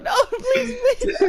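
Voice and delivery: very high squeaky begging through laughter; mock pleading. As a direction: beg in a hammed-up falsetto, half laughing. Acts out pleading not to go inside.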